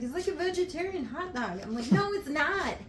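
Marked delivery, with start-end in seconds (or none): 0.0-1.6 higher, lightly mocking boyfriend voice; imitating boyfriend. Quoting the boyfriend's hot dog excuse in a high mock voice. 1.9-2.8 high, loud flat denial; emphatic no. Retold or imitated. Answering the boyfriend's hot dog claim, high loud denial.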